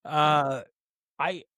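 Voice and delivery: ordinary talk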